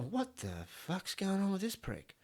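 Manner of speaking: high, soft, slow dazed grumble; a knocked-out bloke coming to; dazed bloke mutter. Acted. The beaten tourist getting up, a high, soft, dazed grumble. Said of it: "beaten man voiced".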